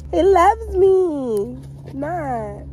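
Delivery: drawn out, "He loves me not" stretched long; slow dramatic finale. Pretend. Teasing the sergeant, drawing it out slowly. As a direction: drag the last line out for drama.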